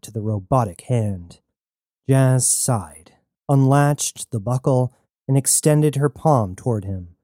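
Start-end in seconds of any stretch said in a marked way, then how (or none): none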